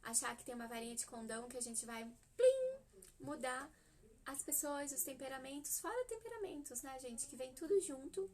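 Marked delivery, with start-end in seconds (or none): 2.3-2.8 high, loud sparkly plim; ping like a wand touching; magic wand plim. Pretend. Mocking a magic wand fix for children, loud high plim.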